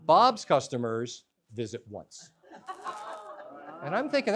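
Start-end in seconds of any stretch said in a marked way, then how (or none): 0.0-2.3 slow, measured recital with pauses; clerk's official pronouncement. Retold or imitated. Clerk quoting research to customer, slow official recital. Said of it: "He goes".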